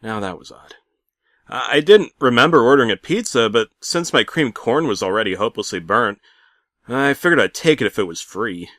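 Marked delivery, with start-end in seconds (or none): none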